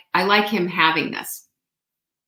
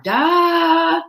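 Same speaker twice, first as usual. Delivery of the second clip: high sarcastic "duh"; mock duh; 9 semitones higher, 4 dB louder than usual. Real, not acted. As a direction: play the obvious, teasing. Mock obvious exclamation.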